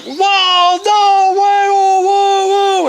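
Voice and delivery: mock crowd of amazed kids, high and loud; long drawn-out high whooping whoas; kids' shocked exclamation. Retold kids' reaction to hit bird.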